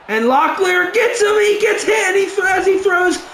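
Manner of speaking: high, rushed excitement; frantic play call. Real, not acted. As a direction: call the hit as it happens. Quarterback hit while throwing.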